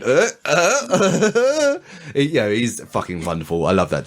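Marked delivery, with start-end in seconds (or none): 0.0-1.8 mock grunts. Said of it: "holding his testicles there going".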